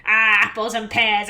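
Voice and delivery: hammed-up attempt at a Cockney accent; badly faked Cockney, held on one note. Player mimicking the washerwoman, admits she can't do it, bad Cockney accent. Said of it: "I can't really do a Cockney ac".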